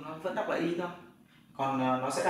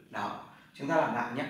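ordinary talk, nothing marked

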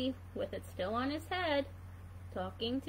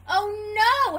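higher, louder cry of dismay; dismayed thought; 6 semitones higher, 9 dB louder than usual. Acted. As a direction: groan it in mock despair. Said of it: "Hedgie thought".

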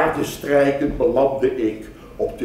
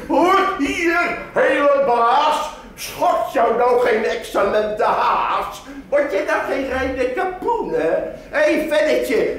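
shrill market wife; 5 semitones higher than usual. Acted. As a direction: call out to the passing buyer like a fishwife. Market women hawking to buyer. (marktvrouw die luid haar waren aanprijst)

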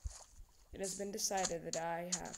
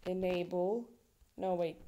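ordinary delivery

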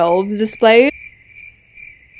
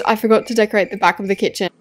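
usual speaking voice

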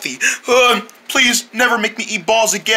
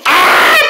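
startled scream; 11 semitones higher, 6 dB louder than usual. Acted. Launched into the air.

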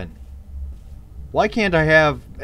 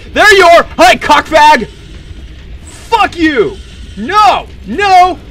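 much higher, much louder yelling mid-fight; frantic shouting; 12 semitones higher, 10 dB louder, slower than usual. Real, not acted. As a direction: scream at the monster in panic.

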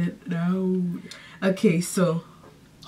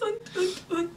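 deer grunting; 10 semitones higher, 9 dB quieter than usual. Pretend. Deer again.

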